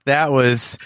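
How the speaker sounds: usual speaking voice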